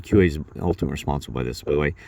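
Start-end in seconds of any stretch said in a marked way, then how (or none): none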